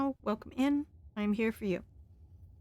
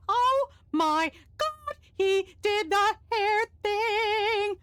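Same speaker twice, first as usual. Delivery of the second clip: very high, slow, shrieking delight; fangirl squeal; 12 semitones higher, 4 dB louder, slower than usual. Acted. Crystal seeing Jax's signature move, fangirl squeal. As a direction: scream like a starstruck fan. Fan screaming at hair move.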